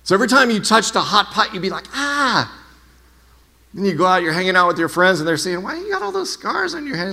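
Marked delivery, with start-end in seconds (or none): 1.9-2.6 high, soft "ah" of pain; mock pained yelp. Someone touching a hot pot, mock pained yelp. 5.6-7.1 high, soft imitation of puzzled friends; curious friends. Friends asking about scars on a hand, high curious mock voice.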